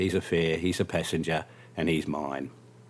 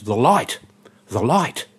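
loud, higher cry of recognition; sudden excited exclamation; 5 semitones higher, 6 dB louder, slower than usual. Retold or imitated. Passenger spotting something familiar from the taxi, excited. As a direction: call it out as a sudden discovery.

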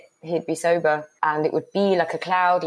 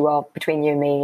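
usual speaking voice

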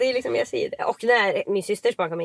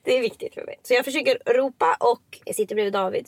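ordinary talk

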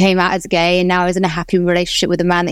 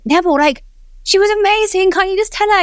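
pleading mum; 12 semitones higher than usual. Retold or imitated. Mum pleading with dad, retold.

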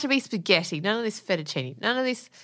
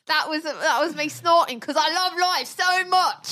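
higher, gushing mock British; hammed-up British accent; 7 semitones higher than usual. Host excusing a snort as the chef, mock British accent. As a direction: gush in a put-on British accent.

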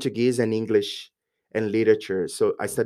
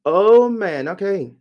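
loud, high "Oh, man" of sudden understanding; exasperated relief; 9 semitones higher, 7 dB louder, slower than usual. Retold or imitated. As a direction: let it burst out, then settle on okay. Finally understanding the request.

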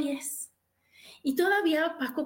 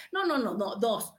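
normal talking voice

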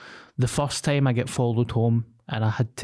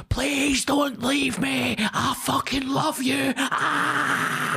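put-on high, slow drunken wail; pleading drunk; 13 semitones higher, much slower than usual. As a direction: whine it like a drunk desperate boy. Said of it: "but it was like".

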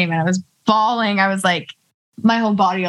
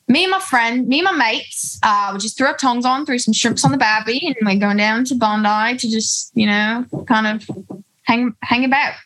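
higher-pitched put-on Aussie accent; attempted Australian accent; 3 semitones higher than usual. Shown as a demonstration. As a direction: improvise in a hammed-up Australian accent. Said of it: "What do Australian people say?".

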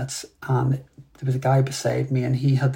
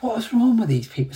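high-pitched exasperated inner outburst; irritated; 9 semitones higher than usual. Retold or imitated. Meditator reacting to someone banging a door, irritated. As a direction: imitate your own irritated thoughts, pitch jumping up. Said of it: "went to this really irritated state".

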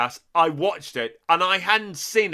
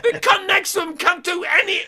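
high, squealing disbelief; comic shrieking indignation; outraged rant; 11 semitones higher than usual. Acted. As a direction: yell it high, utterly incredulous. Outraged rant about the French actor.

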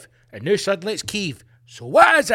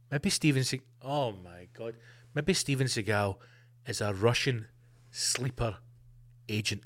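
conspiratorial hush; 7 semitones lower, 9 dB quieter than usual. Pretend. Conspiracy theory whispered aside.

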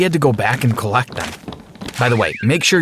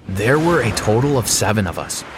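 plain reading voice

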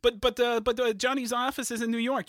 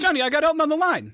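louder, calling over a bad line; 6 semitones higher, 7 dB louder, faster than usual. Producer shouts to his caller over a bad line, calling out.

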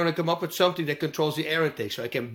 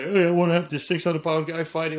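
mock fan request; 2 semitones higher, 4 dB louder than usual. Quoting a crazy fan request.

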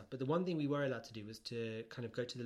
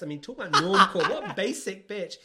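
ordinary talk, nothing marked

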